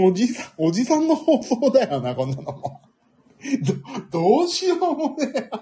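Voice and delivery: laughing through the words; chuckling self-mockingly, pitch up. Real, not acted. Laughing at his own old-man talk. (笑いながら)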